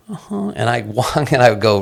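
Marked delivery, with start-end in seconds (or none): 0.1-0.5 little high, soft uh-huh; imitated toddler. Toddler agreeing to whatever mother asks, small high voice. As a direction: agree like a very young child. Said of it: "the reciprocating tone was".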